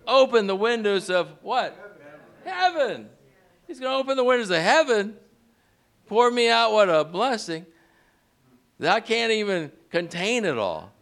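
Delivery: high, loud and rising preacher's call; building sermon climax, calling out to the congregation; fired-up preaching. Acted. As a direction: lift the church with every phrase. Preaching at full force.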